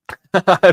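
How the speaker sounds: loud high laugh. Real, not acted. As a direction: laugh out at the chat. Streamer laughing at chat.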